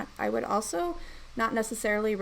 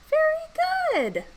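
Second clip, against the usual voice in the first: put-on lightly bright coaching voice for kids; slower than usual. Shown as a demonstration. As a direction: coax the class gently through blending. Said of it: "back to my coaching voice".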